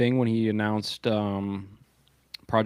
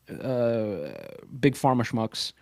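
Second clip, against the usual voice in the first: normal talking voice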